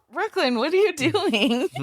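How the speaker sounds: high, incredulous parent question, retold; puzzled question rising at the end; mock bewildered question. Parents to their daughter making weird noises, amused bewilderment. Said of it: "And we're like".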